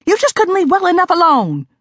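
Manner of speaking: angry barking scolding, rising high. Acted. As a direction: snap at mother in rising anger. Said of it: "I barked some more".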